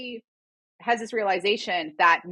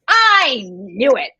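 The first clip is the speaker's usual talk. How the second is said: smug gloat; 3 semitones higher, 8 dB louder, much slower than usual. Retold or imitated. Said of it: "he's like".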